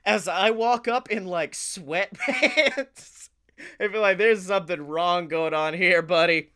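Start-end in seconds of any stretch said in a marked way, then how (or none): none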